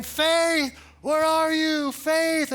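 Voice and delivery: high, loud panicked calling of a name; frantic calling. Retold or imitated. Father searching a pile of laundry, frantic loud calling. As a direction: call out for the lost baby in a panic.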